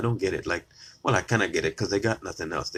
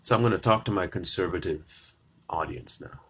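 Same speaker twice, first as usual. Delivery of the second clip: normal talking voice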